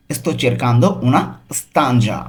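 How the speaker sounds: slow, careful Italian for learners; very slow model reading. Shown as a demonstration. Teacher models the phrase slowly for students. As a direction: spell it out slowly for the class. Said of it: "এর Italian কি হবে?".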